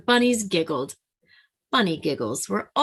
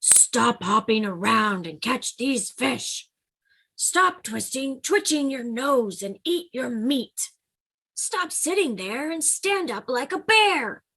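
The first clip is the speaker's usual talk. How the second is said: lightly voiced bears, higher and scolding; 5 semitones higher than usual. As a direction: nag him like bossy big bears. Bears scold Bunny Bear.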